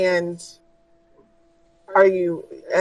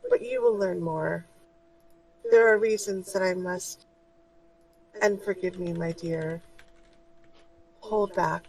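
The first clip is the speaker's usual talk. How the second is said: soft, much quieter motherly voice; gentle spirit guide; 6 dB quieter than usual. Acted. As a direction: reassure her tenderly. Guide speaks.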